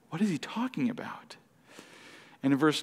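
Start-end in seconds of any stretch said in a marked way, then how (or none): 0.1-1.4 whispered to himself, baffled; puzzled whisper. Retold or imitated. Nicodemus wondering to himself, in a puzzled whisper. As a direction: breathe it like a private puzzled thought.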